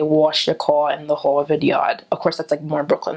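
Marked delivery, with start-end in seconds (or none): 0.0-2.0 put-on slow Long Island accent with a rounded "aw", "caw"; slow, drawn Long Island accent. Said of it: "but we say".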